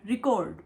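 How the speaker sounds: "रिकॉर्ड" said the Indian English way; Indian English pronunciation. Shown as a demonstration. Mother showing her viewers how India says it, Indian English pronunciation. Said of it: "हम ऐसे बोलते हैं".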